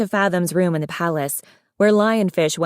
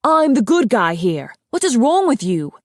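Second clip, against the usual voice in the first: higher, louder exasperated outburst; indignant shouting; 5 semitones higher, 4 dB louder than usual. A narrator's reading. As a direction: protest your innocence at raised volume. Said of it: "Darkstalker shouted".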